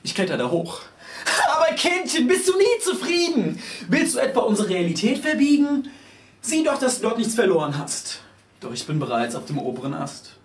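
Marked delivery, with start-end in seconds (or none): 1.6-8.2 put-on high, loud lamenting old woman; grandma wailing at the child, high and loud. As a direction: wail over the youth of today like a granny.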